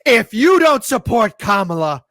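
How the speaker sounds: high, loud scolding rant; mock outraged shout. Streamer mocking Kamala supporters, loud shouting accusation. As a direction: ham up a self-righteous accuser. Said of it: "They're coming on out and be like".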